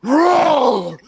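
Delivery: loud, high drawn-out roar; acted-out attacking bellow; mock monster roar. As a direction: roar to scare a sleeping kid. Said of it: "and he goes".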